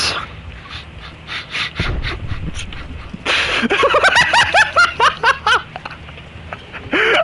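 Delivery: squeaky breathless giggle; wheezing high-pitched laugh. Real, not acted.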